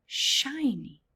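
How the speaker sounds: ordinary delivery, nothing marked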